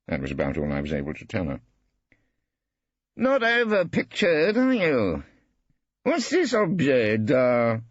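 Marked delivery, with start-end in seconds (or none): 3.2-7.8 put-on high, slow, amused old artist; Brittling mocking. A narrator's reading. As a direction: sneer at the room genially. Brittling surveying the room.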